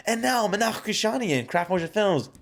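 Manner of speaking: booming high movie announcer. Pretend. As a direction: announce it like a film premiere. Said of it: "imagine it like".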